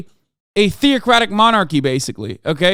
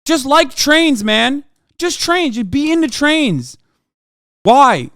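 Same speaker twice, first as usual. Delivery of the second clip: exasperated rant; 5 semitones higher, 5 dB louder than usual. Real, not acted. Exasperated yelling at chat.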